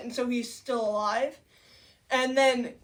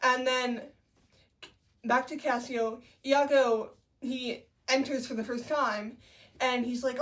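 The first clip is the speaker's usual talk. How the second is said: usual speaking voice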